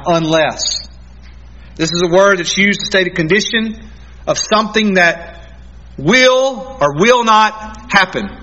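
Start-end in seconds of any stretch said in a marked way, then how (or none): none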